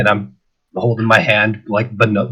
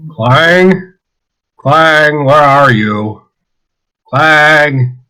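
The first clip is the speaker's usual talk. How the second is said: half-voiced shout, drawn out and raised; calling out; 4 semitones higher, 3 dB louder, much slower than usual. Wandering the docks looking for Clang, mild calling shout. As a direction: call for a missing friend, not too loud. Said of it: "just shouting".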